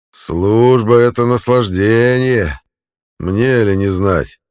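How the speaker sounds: loud mocking parade shout, slow; gruff barked parade orders. A narrator's reading. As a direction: bellow it like a drill sergeant.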